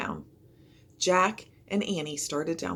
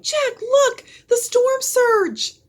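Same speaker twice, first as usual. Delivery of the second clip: higher, louder, slow alarmed scream; 10 dB louder, much slower than usual. A narrator's reading. Annie screaming at the coming surge, a high alarmed scream. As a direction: point in terror at the wave. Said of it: "Annie screamed".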